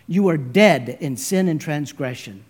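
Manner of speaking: normal talking voice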